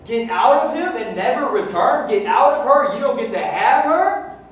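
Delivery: high, loud commanding shouts at spirits; forceful deliverance command. Acted. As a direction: cast the spirits out with full force.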